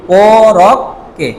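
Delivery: কোরককে stretched very slowly, every syllable held. Shown as a demonstration. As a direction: drag out কোরককে for the class. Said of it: "sorry, কোরককে".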